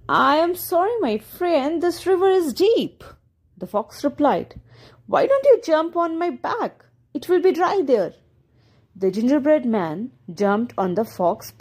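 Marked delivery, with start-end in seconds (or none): 0.1-3.1 high-pitched storybook fox; sly fox feigning regret; coaxing fox. A narrator's reading. Fox coaxing his prey in story. 5.1-8.1 high-pitched inviting fox; sly fox's helpful offer; coaxing fox. A narrator's reading. Fox coaxing his prey in story.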